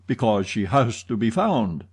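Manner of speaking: plain reading voice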